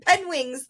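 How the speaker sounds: "penwings" in place of penguins; mispronounced penguins. Retold or imitated. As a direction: quote the slip as "penwings". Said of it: "with him saying penwings".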